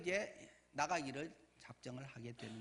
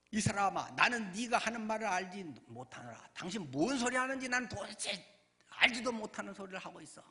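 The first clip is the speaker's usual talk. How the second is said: loud, raised-pitch acted protest; forceful denial; 5 semitones higher, 6 dB louder than usual. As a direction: hammer out an indignant denial as Peter. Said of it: "하면서 막 강력히 이야기합니다". (강력히 부인하는 베드로 흉내)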